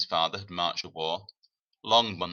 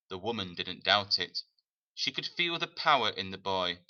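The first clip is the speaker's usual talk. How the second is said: ordinary delivery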